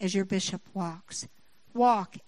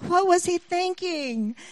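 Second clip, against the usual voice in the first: slow, higher, exasperated; frustrated; 6 semitones higher than usual. Retold or imitated. Wife retelling anger at her husband, slow and exasperated. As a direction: drag it out in disbelief at the husband. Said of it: "the more frustrated I got".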